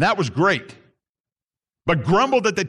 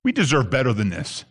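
lower, grumbling take on the entitled people; low grumbling "We deserve better than this"; entitled complaint; 7 semitones lower than usual. Retold or imitated. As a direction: voice their complaint low and sulky. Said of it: "here's what they're really saying".

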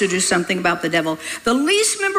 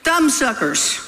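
scolding; 5 semitones higher than usual. Real, not acted. Preacher scolds congregation, laughing.

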